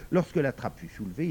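plain reading voice

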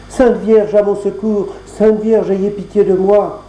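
plus aiguë, implorante; prière suppliante. Acted. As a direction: appelle à l'aide, désespérée. Jeanneton implore dans le bourbier. (Jeanneton prie la Vierge depuis le bourbier, suppliante et aiguë)

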